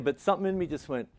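normal talking voice

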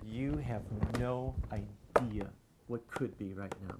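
very slow, hushed divine reply; the Lord speaking. Retold or imitated. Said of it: "the Lord said".

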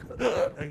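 imitated choking on exhaust smoke; mock choking. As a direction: choke noisily, as if on smoke. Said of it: "you know, choking".

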